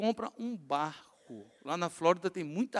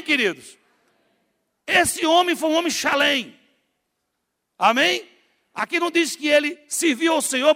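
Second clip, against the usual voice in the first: loud, high fiery sermon; preaching at full force; 3 semitones higher, 9 dB louder than usual. Acted. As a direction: rouse the congregation, full voice.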